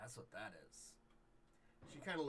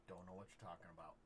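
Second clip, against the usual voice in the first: stern; 3 semitones lower, 7 dB quieter than usual. Acted. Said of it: "looks at you very sternly and goes".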